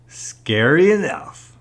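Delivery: high, drawn-out, slow verdict; satisfied kid. A narrator's reading. Lance sees his father fall over, satisfied. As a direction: stretch it out loudly with satisfaction.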